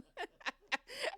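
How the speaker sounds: real laugh at her own blunder; high helpless laugh. Real, not acted. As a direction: giggle rising up high.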